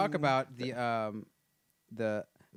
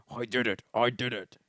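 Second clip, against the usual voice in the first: proud Vader boasting; a hint of Darth Vader; Darth Vader impression. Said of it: "That was Darth Vader".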